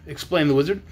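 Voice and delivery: ordinary delivery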